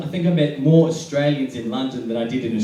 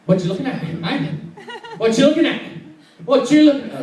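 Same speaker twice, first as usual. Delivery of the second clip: put-on higher, louder belligerent drunk challenge; drunk picking a fight; 12 semitones higher, 4 dB louder than usual. Drunk guy challenging everything he sees, mock belligerence. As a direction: escalate it like a drunk spoiling for a fight. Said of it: "there's always that one guy drunk".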